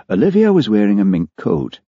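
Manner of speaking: ordinary delivery, nothing marked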